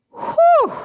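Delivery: high, loud whistle of relief; relieved whew. Retold or imitated. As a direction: blow out a big sigh of relief as the rhino trots off. Relief after the rhino leaves.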